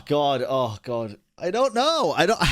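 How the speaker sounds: usual speaking voice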